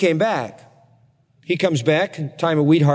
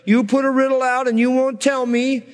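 higher mock whine, nagging on; whining; 8 semitones higher than usual. Wife nagging Samson for his riddle, mock whining. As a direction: nag it, pitched up and pouty. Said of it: "all this whining going on".

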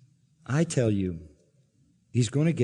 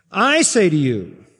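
emphatic quote; 4 semitones higher, 7 dB louder than usual. Shown as a demonstration. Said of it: "this is the voice of absolute divine authority".

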